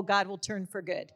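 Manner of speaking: normal talking voice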